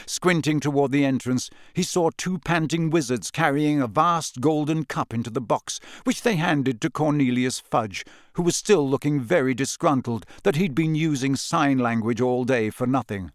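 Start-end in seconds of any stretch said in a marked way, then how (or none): none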